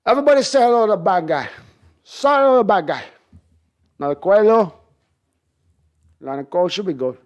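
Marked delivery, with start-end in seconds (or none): 0.1-3.0 higher, louder, fast gangster voice; loud Scarface snarl; Scarface impression. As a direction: swagger it like Tony Montana. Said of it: "can you do a Scarface impression".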